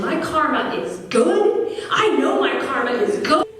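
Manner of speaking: high-pitched indignant protest; mild mock yelling. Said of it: "I was yelling".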